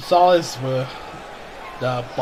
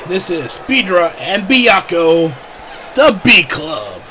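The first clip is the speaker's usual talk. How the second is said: ring announcer; 3 semitones higher, 9 dB louder than usual. Acted. Introduces team to crowd.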